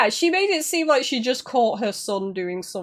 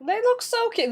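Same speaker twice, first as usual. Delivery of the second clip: cooing; 12 semitones higher than usual. Real, not acted. Cooing over cute Pokémon.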